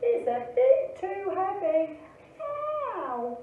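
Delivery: high, acted voice of strain; hammed-up high bear complaint. A narrator's reading. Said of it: "grunted Douglas".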